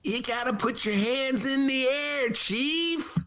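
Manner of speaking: high, drawn-out Jack Nicholson voice; hammy Jack Nicholson imitation; Jack Nicholson impression. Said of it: "That's my Jack Nicholson imitation".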